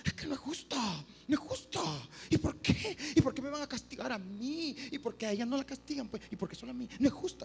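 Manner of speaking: high-pitched indignant whining; aggrieved pupil. Retold or imitated. Pupil objecting to being punished alone, whining indignantly. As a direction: protest in a high voice, piling up the complaints. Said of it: "Y siempre digo".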